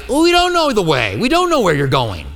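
an honest, baffled protest; a bewildered disciple throwing up his hands; exasperated confusion. Retold or imitated. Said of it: "I love the honesty".

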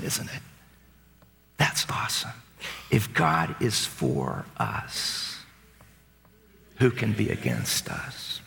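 tender reassurance. Acted. Preacher calming leaders before battle, slow and soft. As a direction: comfort them gently, almost whispering.

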